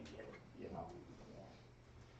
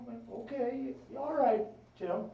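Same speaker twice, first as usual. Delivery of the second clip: put-on much higher, timid kid voice; little brother; 10 semitones higher than usual. Sean agreeing to catch with his big brother, timid high voice. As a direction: give in like a nervous little boy. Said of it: "He goes".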